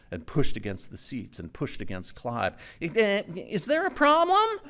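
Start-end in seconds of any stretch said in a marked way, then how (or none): 2.8-4.6 higher, slow call over engine noise; yelling. A narrator's reading. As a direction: yell it back over your shoulder. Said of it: "Tommy yelled over his shoulder".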